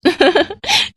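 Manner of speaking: short high giggle; light laugh. Acted. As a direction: giggle shyly at the question. Hina laughs when asked. (हल्की खिलखिलाहट)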